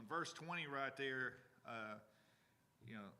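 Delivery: ordinary delivery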